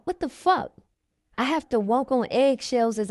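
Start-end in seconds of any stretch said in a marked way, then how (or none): 0.0-0.7 mild yell. A narrator's reading. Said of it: "Sean yelled".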